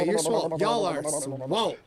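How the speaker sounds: ordinary talk, nothing marked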